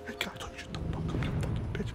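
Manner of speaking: fast whisper from the back; whispered background heckle. Pretend. Dubbing angry bystanders in the background, whispered. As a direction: hiss it as a distant bystander.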